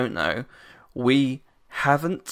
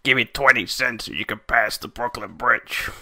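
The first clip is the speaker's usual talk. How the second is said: hammed-up New York accent, troll demanding a toll; New York bridge troll. Bridge troll charging Joey to cross, mock New York accent. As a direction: play a Brooklyn troll shaking down passers-by. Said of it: "Are you a, a bridge troll?".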